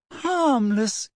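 high, shrill disbelief; incredulous girl's squeal of a question; shrilly. A narrator's reading. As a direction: echo it back in a high, outraged pitch. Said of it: "said Hermione shrilly".

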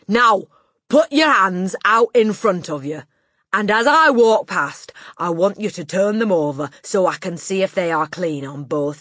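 loud drill-like orders; domineering headmistress, commanding. A narrator's reading. Headmistress ordering a hand inspection, loud and commanding. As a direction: order them about at full volume.

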